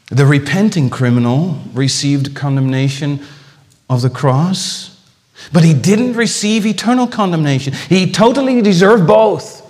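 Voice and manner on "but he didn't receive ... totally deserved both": raised, high-pitched insistence; impassioned preaching. Real, not acted. Preacher contrasting the repenting criminal's fate, impassioned. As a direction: drive the point home forcefully.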